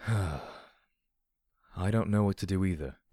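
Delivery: slow, weary admission after a sigh; sigh, then draw the words out. Acted. Companion admits he is lost too, sighing. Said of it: "P twenty-one sighed".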